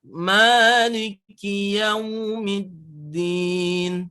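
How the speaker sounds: slow chant holding the long mim in Maliki; slow recitation with a long mim. Shown as a demonstration. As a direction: stretch the mim out. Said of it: "mimnya harus panjang".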